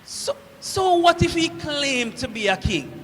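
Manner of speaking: normal talking voice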